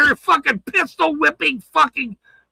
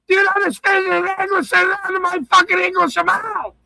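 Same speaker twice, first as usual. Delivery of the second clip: higher and faster, hammed-up movie cop yelling; movie quote voice; 7 semitones higher than usual. Streamer quoting a movie cop at his critics, hammed-up. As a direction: ham up the famous movie line. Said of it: "there's a movie where it".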